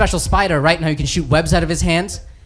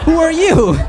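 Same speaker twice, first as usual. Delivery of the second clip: high-pitched questioning; 10 semitones higher, 4 dB louder than usual. Acted. One superhero asking the next who he is, high-pitched. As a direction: question squeaked out high, then falling. Superheroes quizzed on their powers.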